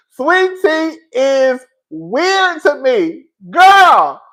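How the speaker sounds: high, slow then loud; loud mock bewilderment; drawn-out disbelief. Real, not acted. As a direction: stretch it out, then cry why. Exaggerated disbelief.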